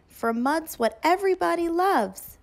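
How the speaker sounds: plain reading voice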